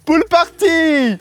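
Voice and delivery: loud, high, drawn-out holler; rowdy party shout. Real, not acted. One friend rousing the would-be sleepers, hollering. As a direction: stretch it out like a party call.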